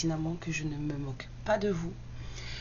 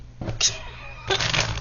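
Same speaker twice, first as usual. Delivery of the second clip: breathy laugh. Real, not acted. Rit de sa propre blague. (petit rire soufflé)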